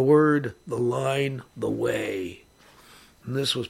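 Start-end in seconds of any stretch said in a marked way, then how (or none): none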